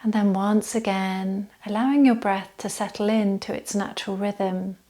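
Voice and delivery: calm meditation voice with long, unhurried pauses; settled, even yoga-teacher relaxation voice; guided body scan relaxation. Acted. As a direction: gently guide them into stillness, leaving space to breathe.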